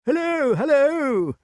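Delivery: high, drawn-out loud halloo; excited high-pitched greeting cry. A narrator's reading. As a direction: call out in a high, eccentric king's voice. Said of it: "cried King Pellinore".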